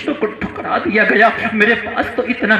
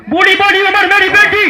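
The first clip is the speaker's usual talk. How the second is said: a hint of a high old woman's voice, louder; 10 semitones higher, 6 dB louder than usual. Preacher voices the old widow answering Umar, higher and louder. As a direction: lightly voice the old widow, raised.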